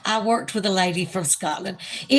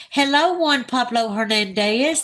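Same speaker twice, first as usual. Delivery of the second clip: ordinary talk, nothing marked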